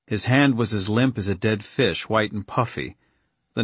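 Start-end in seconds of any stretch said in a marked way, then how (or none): none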